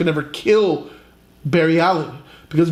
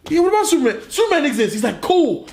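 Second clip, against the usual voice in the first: mock high excitement; 9 semitones higher, faster than usual. Barry checking reality.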